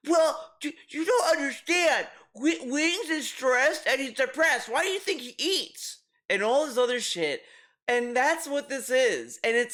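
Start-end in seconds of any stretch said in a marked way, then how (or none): 0.1-6.0 mocking high whiny voice. Mocking DSP defending Wings.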